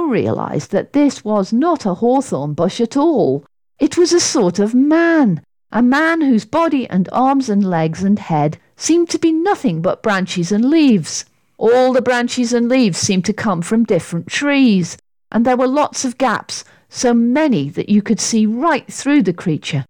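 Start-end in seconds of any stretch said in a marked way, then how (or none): none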